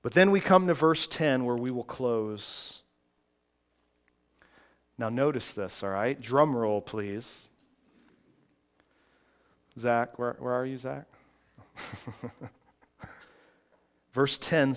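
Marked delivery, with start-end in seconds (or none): none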